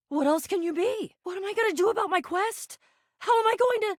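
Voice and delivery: high-pitched, distressed questions, climbing as they go; mounting panic. Acted. Quest leader learns helper is no houseologist, mounting panic. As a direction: let the worry push the pitch up.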